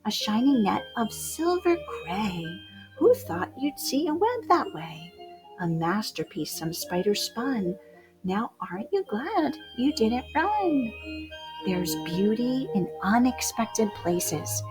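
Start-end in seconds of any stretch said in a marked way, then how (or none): none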